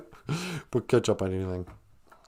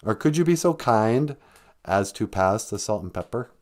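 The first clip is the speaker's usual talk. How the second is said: ordinary talk, nothing marked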